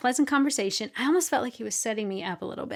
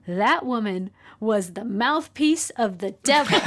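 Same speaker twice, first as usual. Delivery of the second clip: slow, louder, swooping declaration; emphatic pronouncement; 6 dB louder than usual. Retold or imitated. Friend judging the woman who called, emphatic pronouncement. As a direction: pronounce it like a verdict, punching each phrase. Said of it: "she just said to me".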